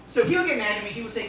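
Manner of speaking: ordinary delivery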